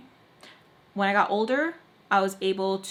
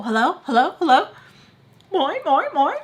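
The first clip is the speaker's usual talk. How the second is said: high, loud calling hello into the phone; 7 semitones higher, 8 dB louder than usual. Retold or imitated. Mom calling hello down the phone, high and loud. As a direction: shout hello down a silent line.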